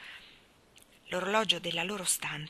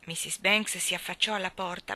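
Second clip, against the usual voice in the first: ordinary delivery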